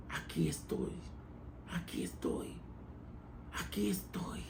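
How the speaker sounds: whispered, pleading under the breath; whispered prayer. Acted. A sinner whispering to God in surrender, hushed.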